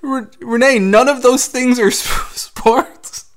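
squeaky incredulous laugh through words; high laughing disbelief. Real, not acted. Laughing disbelief at sports claim.

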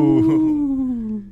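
high, loud ghostly wail of ooh; spooky ghost ooh. Pretend. Telling the massacre story to friends, a spooky ghost ooh.

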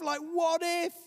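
mock anxious outburst; panicked what if. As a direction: push the fear over the top. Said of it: "It's the voice of what ifs".

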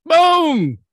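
very high, booming yell; huge shouted boom. Pretend. Co-host replaying the knockout punch, full shout. Repeats knockout hit, bigger.

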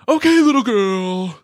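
high, loud hammed-up threat; menacing Cage voice. Host acts out Cage threatening the bearded guy, hammed-up and loud. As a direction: taunt him like an unhinged tough guy. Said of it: "where he says".

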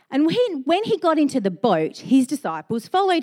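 measured Bible reading; scripture reading. Acted. Pastor reads Matthew to the church, measured reading voice. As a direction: read the verse to the congregation.

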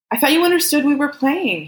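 high-pitched, pleading excuse; defensive protest. A narrator's reading. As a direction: protest your innocence, voice rising high. Man defends himself in quarrel.

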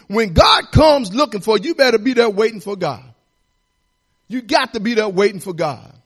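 loud, high exhortation; preacher calling the church to believe; full-force preaching. Acted. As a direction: drive it home to the pews.

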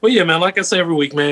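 ordinary delivery

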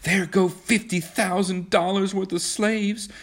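high, slow, drawn-out exclamation; high-pitched lament over the din; crying out. A narrator's reading. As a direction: cry out over the bombing, stretching the words. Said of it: "cried Kismine".

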